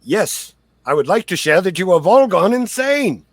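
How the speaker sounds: loud, high affronted accusation; indignant outburst. Acted. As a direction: blast them as an outraged victim. Acted accusation of the party.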